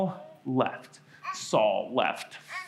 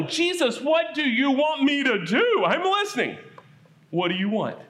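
higher, eager, a touch of fear; pleading Saul; 5 semitones higher than usual. Acted. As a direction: beg for instructions, a little scared.

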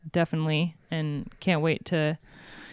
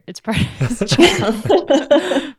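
laughing; 9 semitones higher, 8 dB louder, much slower than usual. Real, not acted. Laughing at own joke.

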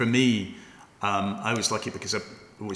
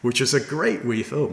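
ordinary talk, nothing marked

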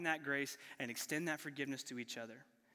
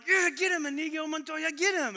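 much higher and louder, yelling like a fan in the seats; cheering shout; 11 semitones higher, 8 dB louder than usual. Retold or imitated. Audience cheering on Inigo's revenge, loud cheering shout. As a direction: egg the hero on at full volume. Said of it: "and we're like".

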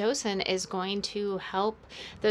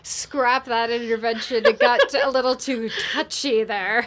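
laughing; 3 semitones higher, 7 dB louder than usual. Real, not acted. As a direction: laugh while backing out. Laughing at her own blunder.

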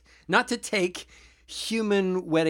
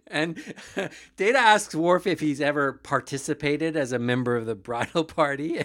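ordinary talk, nothing marked

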